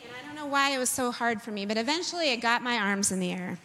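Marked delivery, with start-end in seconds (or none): none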